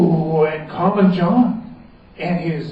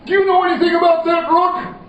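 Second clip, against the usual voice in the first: questioning king; 13 semitones higher, 4 dB louder, faster than usual. Acted.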